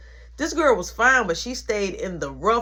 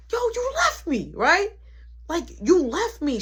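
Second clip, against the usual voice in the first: pitched way up, hurt and accusing; high whiny complaint; 8 semitones higher than usual. Retold or imitated. In the car confronting Jamie, high whiny accusation. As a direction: whine it at her like a betrayed kid.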